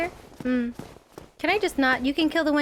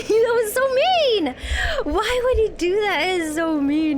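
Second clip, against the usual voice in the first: mock crying; 7 semitones higher, 5 dB louder than usual. Real, not acted. Pushed off in game.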